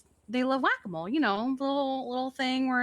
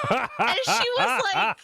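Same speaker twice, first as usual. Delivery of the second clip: laughing; 9 semitones higher, 6 dB louder, slower than usual. Real, not acted. Laughing while retelling.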